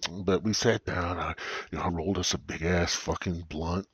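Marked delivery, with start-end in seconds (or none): none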